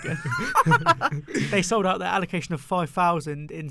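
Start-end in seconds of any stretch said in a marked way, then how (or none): 0.2-1.5 loud, high-pitched laugh. Real, not acted. Jinxed a second time.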